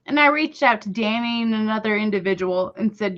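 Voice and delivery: normal talking voice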